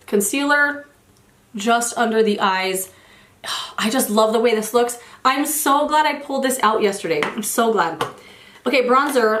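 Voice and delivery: normal talking voice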